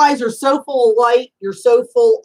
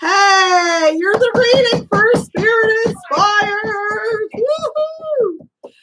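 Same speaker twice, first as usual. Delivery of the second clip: excited greeting; 11 semitones higher, much slower than usual. Real, not acted. Host greets caller on air.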